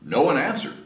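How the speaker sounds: ordinary delivery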